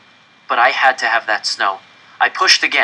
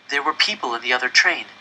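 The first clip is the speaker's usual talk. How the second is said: ordinary delivery, nothing marked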